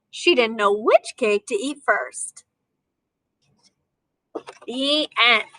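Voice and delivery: lively storybook reading to a class; reading to children. A narrator's reading. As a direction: read it like a picture book for kids. Teacher reads picture book aloud.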